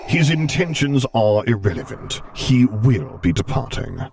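each word a final decree; slow stern Vader decree; Vader's voice. A narrator's reading. Vader overrules, voiced in story.

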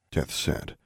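ordinary delivery

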